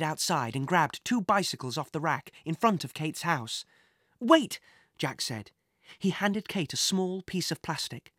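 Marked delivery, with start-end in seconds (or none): 4.2-4.6 urgent call. Acted. Sudden call to stop.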